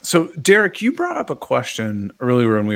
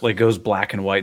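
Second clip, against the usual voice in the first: ordinary delivery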